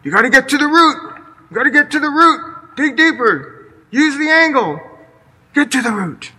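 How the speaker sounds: put-on father drilling his kid on weeding; stern dad voice. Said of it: "my dad's voice".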